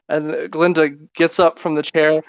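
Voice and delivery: ordinary talk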